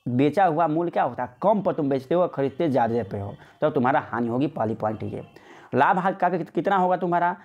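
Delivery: ordinary talk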